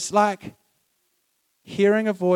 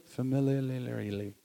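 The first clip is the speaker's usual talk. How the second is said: trying the word out; 6 semitones lower, 13 dB quieter, slower than usual. Shown as a demonstration. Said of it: "Is that a word?".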